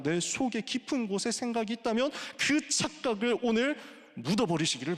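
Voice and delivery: high, driving exhortation; full-force preaching. Real, not acted. Preacher urging people to hold to the covenant, preaching at full force. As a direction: exhort the congregation with mounting force.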